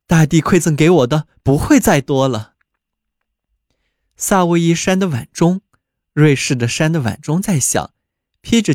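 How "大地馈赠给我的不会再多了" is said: usual speaking voice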